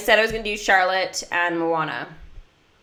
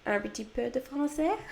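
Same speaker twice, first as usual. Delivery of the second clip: a soft little line in French with a French accent, much softer; 4 semitones higher, 10 dB quieter than usual. Shown as a demonstration. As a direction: shyly show off her French accent. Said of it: "I do have a pretty good French accent".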